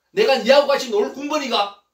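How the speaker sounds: loud, high haughty dismissal; acted indignant scolding; scornful put-down. Acted scornful insult by [name].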